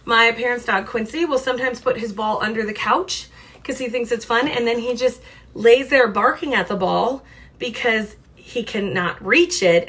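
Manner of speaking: usual speaking voice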